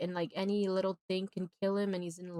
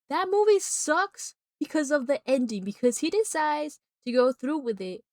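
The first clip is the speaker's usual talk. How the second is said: loud, raised-pitch complaint; fed up, louder and higher; indignant rant; 5 semitones higher, 6 dB louder than usual. Real, not acted. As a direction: vent loudly about the ending. Climax of rant about ending.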